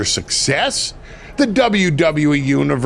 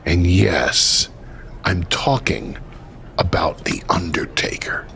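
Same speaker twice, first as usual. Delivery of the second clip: low, whispered reveal of the name; lowered menacing whisper of the Undertaker's name; ominous whisper; 8 semitones lower than usual. Acted. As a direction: drop to a hushed, spooky whisper.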